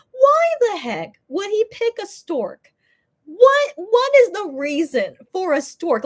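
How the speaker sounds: high, incredulous mock question; baffled. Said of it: "I'm like".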